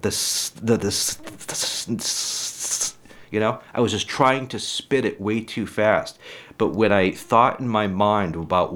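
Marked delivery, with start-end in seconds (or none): 0.0-3.0 The s- the s- trailing into soft hissed s- s-; demo stutter. Shown as a demonstration. Showing how he rushed his words, stuttering. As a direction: rush it and get stuck on the S. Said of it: "back then I'd be like".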